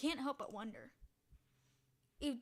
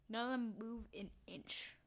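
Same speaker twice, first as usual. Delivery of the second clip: usual speaking voice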